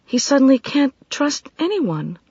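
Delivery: put-on high, sobered worried young voice; steady yourself, still shaken. A narrator's reading. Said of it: "In a sobered voice".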